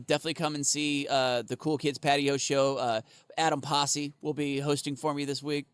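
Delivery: normal talking voice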